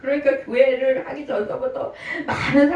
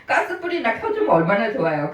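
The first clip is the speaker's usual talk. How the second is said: usual speaking voice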